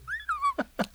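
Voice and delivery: squeaky high laugh; high burst of laughter. Real, not acted. Laughing at the Jack Handey punchline, a high squeal. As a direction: crack up at the joke.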